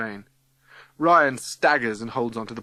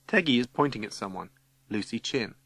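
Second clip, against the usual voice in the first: usual speaking voice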